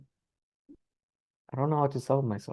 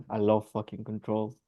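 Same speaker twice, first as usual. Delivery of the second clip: ordinary talk, nothing marked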